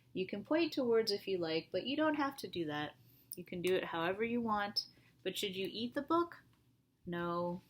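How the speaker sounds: usual speaking voice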